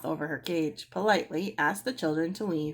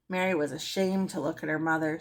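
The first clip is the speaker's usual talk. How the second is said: plain reading voice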